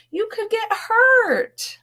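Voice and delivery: tiny high mouse voice, slow and worried; squeaky mouse. Acted. Mice pleading with their friend, high and squeaky. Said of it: "squeaked her friends".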